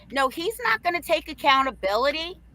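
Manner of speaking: ordinary delivery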